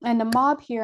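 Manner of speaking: ordinary delivery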